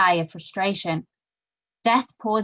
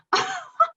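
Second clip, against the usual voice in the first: high nervous laugh of relief; relieved laugh. Acted. Woman laughs nervously with relief at Death. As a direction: giggle off the fright.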